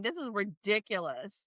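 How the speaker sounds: ordinary delivery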